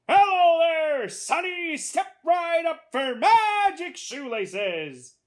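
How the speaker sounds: high, loud, slow barker's pitch; showy shoelace salesman. A narrator's reading. Salesman drawing a boy to magic laces, loud showman. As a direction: hawk your wares, stretching every phrase.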